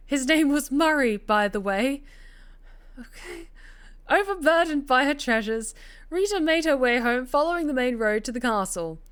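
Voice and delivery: ordinary delivery, nothing marked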